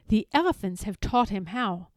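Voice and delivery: ordinary delivery, nothing marked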